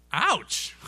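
high mock cry of pain; pained yelp. Smacked from behind a second time, mock pained yelp. Acts out being smacked again.

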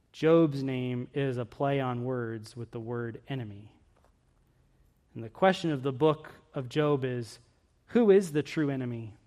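ordinary delivery

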